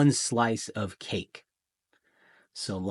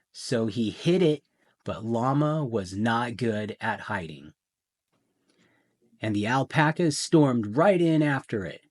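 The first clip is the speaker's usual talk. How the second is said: plain reading voice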